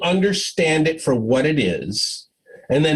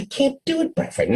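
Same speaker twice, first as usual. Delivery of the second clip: much higher, quick pleading protest; a frustrated employee refusing again, pitched high; exasperated refusal; 11 semitones higher, faster than usual. Retold or imitated. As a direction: whine it back at the boss, quick and high.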